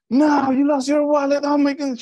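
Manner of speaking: imitated loud, high-pitched alarm; alarmed sister. Sister hearing on the phone about lost wallet, alarmed.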